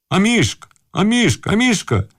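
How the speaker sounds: high-pitched calls, repeated; an old man calling his dog, voice lifted. A narrator's reading. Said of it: "кричал старичок".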